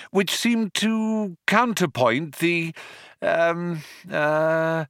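high, slowing, stuck on drawn-out ums; floundering. A narrator's reading. Arthur bluffing about the poem, floundering. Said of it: "he floundered".

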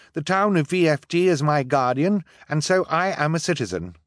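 put-on high, firm girl's voice; determined girl. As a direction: stand your ground. Violet argues.

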